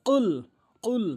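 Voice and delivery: "কুল" with q from the tongue root; correct qaf. Shown as a demonstration. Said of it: "গোড়া উপরের তালুর সঙ্গে".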